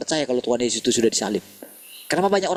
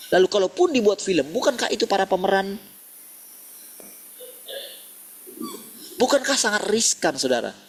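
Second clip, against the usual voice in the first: raised, ringing preacher's voice, pitched higher; fervent preaching; 8 semitones higher than usual. Acted. Preacher urging congregation in a full-force sermon voice. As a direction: lift the congregation with rising conviction. Rising rhetorical questions.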